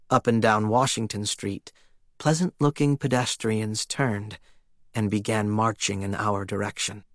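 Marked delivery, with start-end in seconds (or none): none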